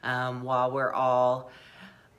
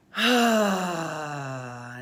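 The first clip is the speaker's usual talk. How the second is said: long, loud voiced "ahh" sigh; noisy sigh; 5 dB louder than usual. Shown as a demonstration. Leader giving listeners permission to make noise, loud releasing sigh. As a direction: sigh out audibly, letting all tension go. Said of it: "It's okay to make noise".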